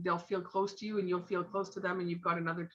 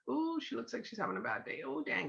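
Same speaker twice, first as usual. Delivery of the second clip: inner thoughts; 3 semitones higher, 8 dB quieter than usual. Retold or imitated. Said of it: "the girl in me before".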